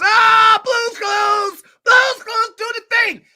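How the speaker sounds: high, loud mock shouting; mock fan clamour. Crowd pestering kids' show host on a date, mock excited shouting. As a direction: holler like an imagined crowd spotting a star.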